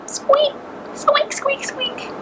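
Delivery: tiny high squeaking, the last one fading to a breath; high mouse squeaks, dying away. Acted. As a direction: squeak like a frightened little mouse.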